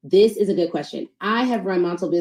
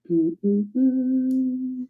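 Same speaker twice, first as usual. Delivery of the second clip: humming to herself; 4 semitones higher than usual. Real, not acted. Humming while writing script.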